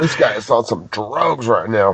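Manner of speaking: low, soft, slow mock lady voice about a caller on drugs.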